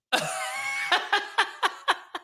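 high, wheezing laugh; high-pitched burst of real laughter. Real, not acted. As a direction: crack up helplessly. Cracks up at own example.

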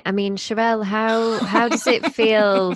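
loud playful prodding question; teasing. Real, not acted. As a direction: tease her, push for an answer. Playfully grills single co-host.

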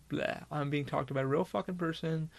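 ordinary talk

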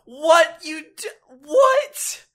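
high, shocked "what?" cracking upward; can't believe what he just heard; stunned disbelief. Real, not acted. Shocked by news of the pool.

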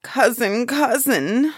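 high, loud appeal; dismayed cry. A narrator's reading. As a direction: cry out in protest. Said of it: "the giant cried".

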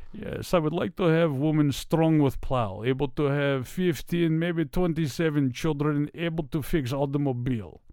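hammed-up Russian accent. Shown as a demonstration. Man listing what he wants in a wife, Russian accent. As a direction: put on a thick Russian accent. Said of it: "a very stereotypical Russian accent".

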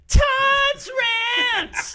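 high, loud mock rant shout; mock angry. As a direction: yell it like a ranting host. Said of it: "my anger got ahead of me".

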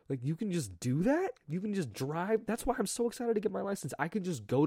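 high, rising amazed questions; giddy excitement. Real, not acted. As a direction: gush like freedom is a miracle. Giddy about driving freedom.

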